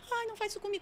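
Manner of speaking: put-on high, pleading little-kid voice; child's voice. Demon playing a crying child to the minister, high childlike plea. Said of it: "uma voz de criança".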